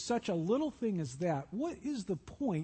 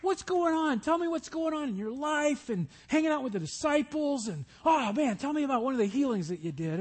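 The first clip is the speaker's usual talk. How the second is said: higher, keen questioning voice; eager Mary; 6 semitones higher than usual. Acted. Mary eagerly quizzing Jesus on the couch, higher voice. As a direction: ask like a fan hungry for stories.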